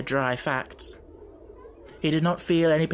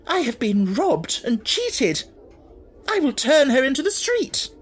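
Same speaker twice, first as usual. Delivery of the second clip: furious outburst; 11 semitones higher, 5 dB louder than usual. Acted. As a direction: let the rage boil over. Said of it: "If she had not been so furious".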